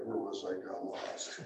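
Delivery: ordinary delivery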